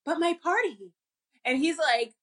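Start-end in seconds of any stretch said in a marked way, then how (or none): 0.0-0.9 put-on reluctant girlfriend.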